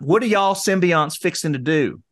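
put-on playful Georgia or Tennessee accent; Southern accent. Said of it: "how you'd say it in Georgia or Tennessee".

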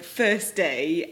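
ordinary talk, nothing marked

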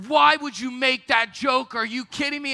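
hammed-up angry scolding; imitating a yell. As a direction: retell her telling off with a mild mock yell. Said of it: "she yelled at me".

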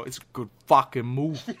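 a touch of Boston in good fucking movie; light Boston movie verdict; dad's Boston accent. Retold or imitated. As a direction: imitate dad's Boston quip. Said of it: "in that voice".

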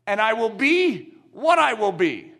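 usual speaking voice

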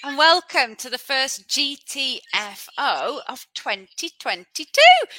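bright host welcome; podcast intro. Acted.

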